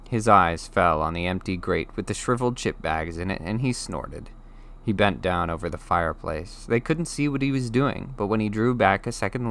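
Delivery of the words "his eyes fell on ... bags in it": ordinary delivery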